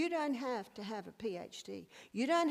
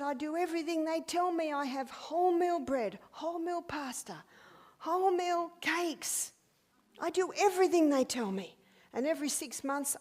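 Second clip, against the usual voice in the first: frustrated lady; 5 semitones higher, 5 dB louder than usual. Retold or imitated. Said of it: "She said".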